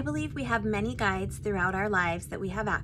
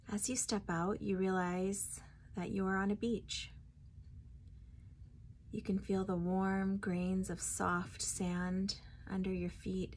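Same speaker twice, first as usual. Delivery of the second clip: guided meditation; 5 dB quieter than usual. Acted. Guide leading listeners to meet their spirit guide, guided meditation. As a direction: ease them inward, phrase by phrase, unhurried.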